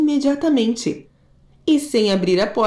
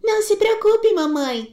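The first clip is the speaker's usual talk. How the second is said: a tiny goat reassuring mum; little kid goat; 11 semitones higher than usual. Acted. Kid goat reassuring its mother, high.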